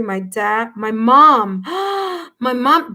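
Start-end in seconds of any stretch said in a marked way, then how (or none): 1.7-2.3 sharp, high gasp; sudden astonished intake of breath; shocked gasp. Real, not acted. Reader hears viewer confirm it was her mom, shocked gasp. Struck by mom's comment.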